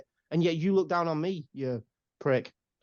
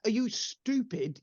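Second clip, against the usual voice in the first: scornful question; 5 semitones higher, slower than usual. Retold or imitated. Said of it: "And he's like".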